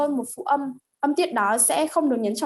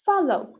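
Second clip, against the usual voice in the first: "follow" high on the first syllable, falling on the last; teacher's example "follow", first syllable stressed; unstressed final syllable; 5 semitones higher than usual. Shown as a demonstration. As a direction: stress the start of "follow", let the final O drop. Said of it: "final syllable is also unstressed".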